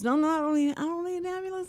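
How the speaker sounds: high-pitched imitated protest; retold stubborn refusal; insistent refusal. Retells husband refusing the ambulance.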